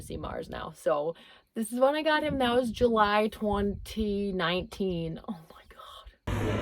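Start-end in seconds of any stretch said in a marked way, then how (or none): 5.3-6.6 awed whisper. Real, not acted. Reacting to first seeing Mars.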